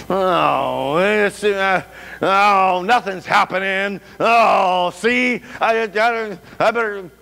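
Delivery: hammed-up grumbling and groaning. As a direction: grumble in discomfort, wading into cold river water. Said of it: "you grumble and complain".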